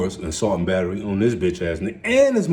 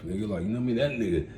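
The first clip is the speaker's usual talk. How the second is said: ordinary talk, nothing marked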